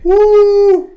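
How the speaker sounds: loud high cheer; excited whoop. Real, not acted. Cheering for the song.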